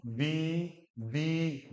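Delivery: "दी" held long; long vowel syllable. Shown as a demonstration. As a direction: stretch the vowel of "दी". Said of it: "ई को यहाँ पे क्या बोलना".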